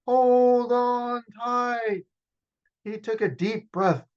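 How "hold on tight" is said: high, loud, drawn-out shout. Acted. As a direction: yell it to the crew over the race. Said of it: "Dom shouted".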